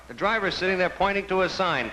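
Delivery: ordinary talk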